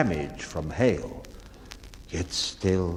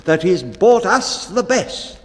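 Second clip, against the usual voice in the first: crew protesting; 9 semitones higher, 7 dB louder than usual. A narrator's reading. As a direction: cheer the captain loudly. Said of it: "so the crew would protest".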